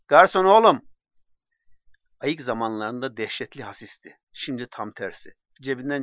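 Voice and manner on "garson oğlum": higher and louder, calling across the café. Acted. Drunk Osman Bey summoning the waiter, calling out loudly. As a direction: hail the waiter from afar.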